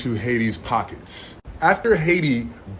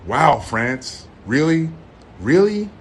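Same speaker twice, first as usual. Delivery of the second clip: rising shocked disbelief; incredulous; 4 semitones higher, 4 dB louder, slower than usual. Real, not acted. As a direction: pitch climbs higher with each really. Outraged disbelief at France.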